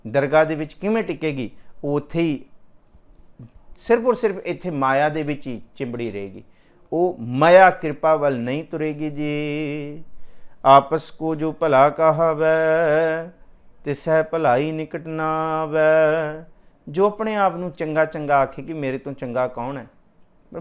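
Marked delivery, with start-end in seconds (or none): 3.8-10.0 raised, ringing preacher's voice, driving each verse home; fervent preaching. Acted. Preacher to the congregation on ego, fervent preaching. Katha preaching at full force. 10.7-16.5 loud, very slow, drawn-out verse; slow scripture recitation. Acted. Preacher reciting Gurbani to the congregation, slow intoning.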